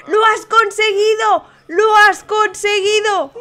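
slowly stretched triumphant cry; excited cheer. Real, not acted. Host celebrates a player who made it, excited cheer.

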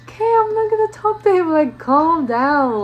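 high, loud, rushed protest slowing on "calm down"; exasperated retort. Retold or imitated. Speaker to her ballistic coworker, exasperated.

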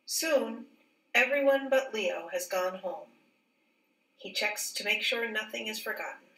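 plain reading voice

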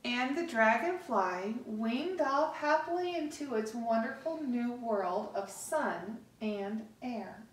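plain reading voice

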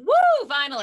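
high, loud whoop of relief; relieved cheer. Real, not acted. Host to guests as the screen share works at last, relieved cheer. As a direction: whoop it out, finally there.

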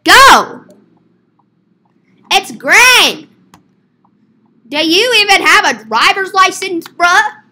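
high, loud road rage; impatient yelling. Pretend. Driver yelling at whoever blocks the green light, impatient. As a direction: yell at the driver ahead.

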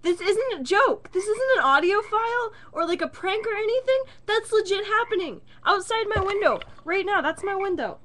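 high, loud and urgent; excited. Real, not acted. Frog croaking outside, excited alarm.

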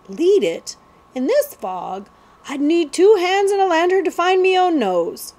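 cockney lad, rising in protest; protesting street boy. Acted. Jemmy protests.

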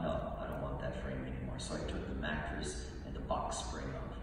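ordinary delivery

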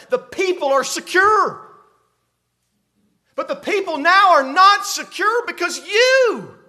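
building to high, loud accusation; impassioned rebuke. Acted. Isaiah charging Ahaz with failing his people, indignant. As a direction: climb in pitch as you press the charge.

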